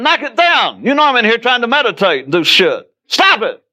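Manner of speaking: very high, exasperated holler; irritated hollering. Acted. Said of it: "You're hollering".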